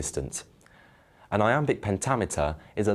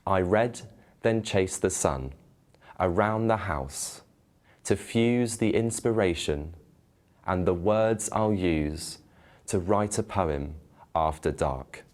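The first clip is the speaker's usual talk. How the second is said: slow reading marking each unstressed-stressed beat; iambic rhythm. Shown as a demonstration. Host reading an example to students, slow iambic beat. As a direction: tap out the da-DUM of every foot. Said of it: "Listen out for the unstressed and stressed syllables".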